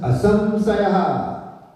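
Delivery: "Asamsaya" drawn out very slowly, high and loud; emphatic Asamsaya. Retold or imitated. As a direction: declare "Asamsaya" slowly as a final word, no doubt left. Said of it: "But Krishna said".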